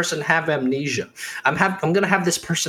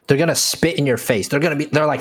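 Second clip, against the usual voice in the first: ordinary talk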